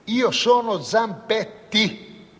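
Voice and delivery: io sono Zampetti spoken very slowly, each word weighted; slow emphatic self-introduction. Acted. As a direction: spell out io sono Zampetti with heavy slow stress. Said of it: "I repeat".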